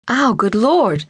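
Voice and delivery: a man yelping in shock under a cold shower; startled yelp. Acted. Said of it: "a voice yelled from the bathroom".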